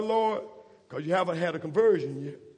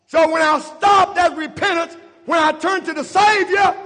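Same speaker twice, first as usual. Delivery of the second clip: loud rising sermon voice, shouting the key lines; full-force preaching; 10 semitones higher, 11 dB louder than usual. Real, not acted. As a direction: preach it hard and build to a shout. Preacher builds to full-force climax.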